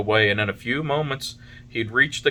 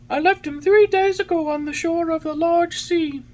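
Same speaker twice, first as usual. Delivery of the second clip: very high, louder bird voice; pigeon voice; 6 dB louder than usual. A narrator's reading. Pigeon tells Pinocchio where his father was, in pigeon voice.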